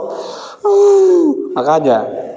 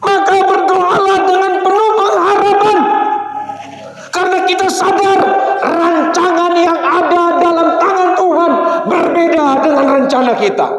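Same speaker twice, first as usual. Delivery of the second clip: raised, fervent preaching; 10 semitones higher than usual. Acted. Pastor exhorting the congregation, raised fervent preaching. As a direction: proclaim it to the whole church.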